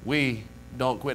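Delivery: ordinary talk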